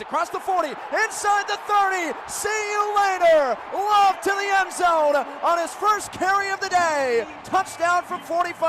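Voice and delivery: high, loud shouting with a stretched name; excited pick six call. Real, not acted. Announcer shouting an interception return touchdown. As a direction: let it rip on the interception return.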